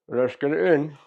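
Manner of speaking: talking round screws held in teeth; screws in mouth. Real, not acted. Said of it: "I've got a couple of screws in my mouth".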